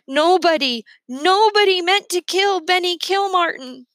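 high, loud wail of grief; crying out. A narrator's reading. Boy to the lieutenant about Benny, high crying wail. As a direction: cry it out like a wounded wild thing. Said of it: "I can hear me crying".

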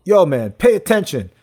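impatient, slow scolding; a bored worker snapping back at a customer; annoyed clerk. Retold or imitated. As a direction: tell him off like a fed-up clerk. Guy scolds him.

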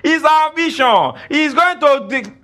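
high, drawn-out mock complaint; scoffing mockers. Preacher imitating idle critics of church planting, high slow scoffing. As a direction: stretch it out high, imitating the doubters. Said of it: "Some people sit down and cross their leg".

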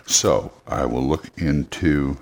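normal talking voice

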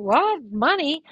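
high, loud disbelief; baffled surprise. Retold or imitated. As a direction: react as if caught off guard. Caught out about the money.

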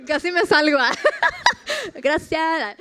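words breaking into real laughter; laughing. Real, not acted. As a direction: crack up while telling it. Laughs through her own retelling.

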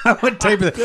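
normal talking voice

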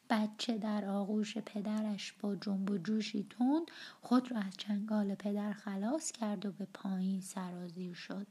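ordinary delivery, nothing marked

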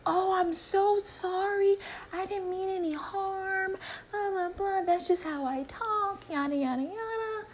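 high, breezy mock apology; mocking coworker voice. Mimicking the coworker who called her stupid, high and breezy. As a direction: imitate her careless sorry in a high voice.

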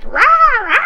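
loud, high squeals of glee; excited mom. Retold or imitated. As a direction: mom like a giddy little kid. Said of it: "She's like".

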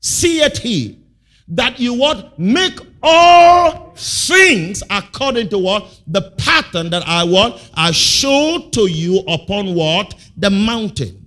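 loud, high, slowed scripture reading; fervent preaching. Acted. Preacher proclaiming the verse, loud and high.

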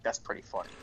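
low, hushed confidential aside; secretive father. Retold or imitated. Father to son while mom isn't looking, secretive. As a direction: murmur it furtively, barely voiced. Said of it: "she wasn't looking".

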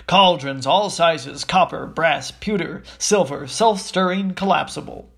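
loud, punchy list of wares; shop sign announcement. Acted. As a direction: proclaim each item like a street seller. Shop sign read out like advert.

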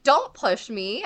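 a half-replayed warning shout; retold yell. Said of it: "yelled at him".

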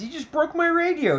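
very high-pitched imitation of her complaint; put-on high voice, protesting; mock outraged protest. Ali confronts Johnny over the broken boombox, high mock protest.

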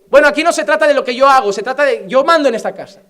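the dad who won't be questioned, fast and loud; bossy father. Acted. Father voiced, bossy retort.

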